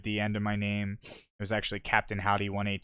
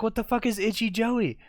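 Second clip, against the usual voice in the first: baffled; 12 semitones higher than usual. Retold or imitated. Retold confusion.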